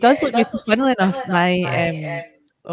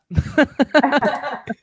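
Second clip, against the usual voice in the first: bright burst of laughter; laughing at her own admission; 7 semitones higher, 3 dB louder than usual. Real, not acted. She laughs at owning up to it.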